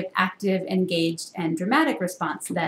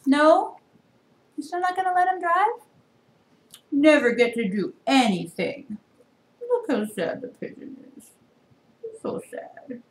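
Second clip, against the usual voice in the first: high, animated picture-book voice; reading to children; 12 semitones higher than usual. Acted. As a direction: talk up to the little ones. Reader commenting in high voice.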